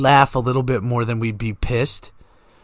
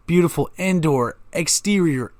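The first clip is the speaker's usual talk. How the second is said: ordinary talk, nothing marked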